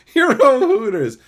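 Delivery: high, loud mock correction; amused shouting. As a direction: exclaim it, cracking up. Mock outrage at chat's wording.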